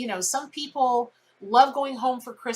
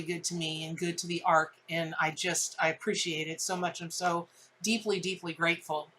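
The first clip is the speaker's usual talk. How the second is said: deeply grateful; 4 semitones lower, 6 dB quieter than usual. Real, not acted. Moved thanks to viewers.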